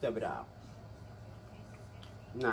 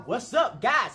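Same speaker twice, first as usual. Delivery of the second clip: ordinary delivery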